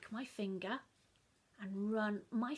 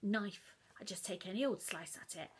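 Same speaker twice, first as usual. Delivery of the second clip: plain reading voice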